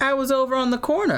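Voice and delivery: put-on high, innocent, carefree girl voice; high mock innocent voice. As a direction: play dumb in a high voice. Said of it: "She was like".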